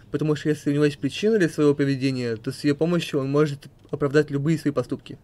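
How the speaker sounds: ordinary talk, nothing marked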